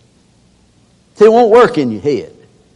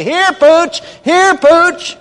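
calling the dog; 13 semitones higher, much slower than usual. Retold or imitated. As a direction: sing out for the dog from the porch. Man calling his missing dog.

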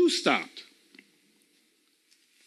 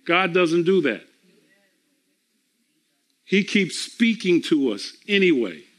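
ordinary talk, nothing marked

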